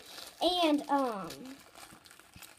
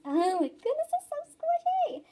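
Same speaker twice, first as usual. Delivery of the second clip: very high squeal of delight; high-pitched cooing squeal; excited squeal; 13 semitones higher than usual. Real, not acted. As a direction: squeal it like it's adorable. Cooing over a squishy toy.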